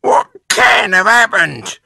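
high, loud alarmed wife; Mrs Twit. A narrator's reading. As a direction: screech it in bewilderment. Missus Twit alarmed by her stick.

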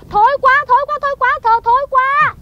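high, loud jeering cry of disgust; wife jeers at her husband's verse, loud and mocking; mocking shout. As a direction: shout it out to mock his poem. Wife mocks husband's poem.

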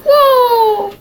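high startled whoa. Pretend. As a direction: yelp as if falling. Pretend fall.

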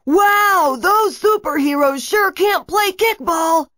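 very high, loud bellow in a parent's voice. Acted. Villain disguising voice as a heckling parent, bellowing. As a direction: bellow it like a jeering parent in the stands. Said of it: "made it sound like one of the parents".

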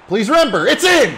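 screaming high and loud; full-throated goal roar; goal call. Real, not acted. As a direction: erupt as the ball goes in. Commentator calls a goal.

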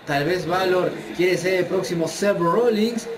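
excited ringside play-by-play; wrestling commentator. Acted. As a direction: call the match like a wrestling announcer. Live play-by-play of the match.

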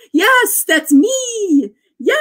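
lightly voiced Kermit yell, high and loud; a quick Muppet-style cheer. Streamer imitating Kermit cheering for a viewer, light Kermit yell. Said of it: "how the Muppets would do the".

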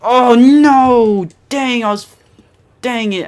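real gamer frustration shouted; dismayed yell. Real, not acted. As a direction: yell as the character dies. Dies while holding the gun.

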